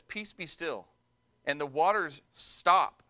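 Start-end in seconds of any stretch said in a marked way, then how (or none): 0.0-0.9 softly and lower, a quiet word that stills the sea; understated, gentle authority; calm hushed command. Acted. Jesus hushing the storm for his disciples, softly and calmly.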